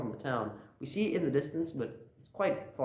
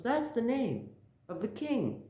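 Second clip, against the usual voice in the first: high, slow exclamation; acted proud reveal; 11 semitones higher, slower than usual. Bartender revealing whose name it is, high excitement. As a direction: announce it as a grand revelation.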